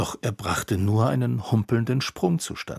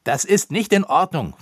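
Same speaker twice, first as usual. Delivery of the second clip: higher and louder angry cry; boy crying out against the elf, higher and louder; exclaimed; 8 semitones higher, 6 dB louder than usual. A narrator's reading. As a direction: call it out in protest. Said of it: "rief er".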